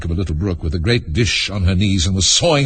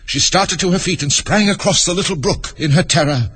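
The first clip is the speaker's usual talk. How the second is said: higher, urgent storytelling; raised, breathless-sounding climax narration; dramatic story narration; 10 semitones higher than usual. A narrator's reading. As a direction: build the alarm of the uproar, pitched up.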